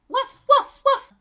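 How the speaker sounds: high, loud yapping barks; three sharp, high woofs; dog barking. A narrator's reading. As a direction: bark like the neighbours' dog. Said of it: "aboie le chien des voisins".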